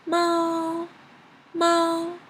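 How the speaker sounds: "Ma" held high and level, as if sung; first tone. Shown as a demonstration. Teacher matching the sung example, high level "Ma". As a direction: sing out "Ma" on a flat high note. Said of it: "that is the first tone".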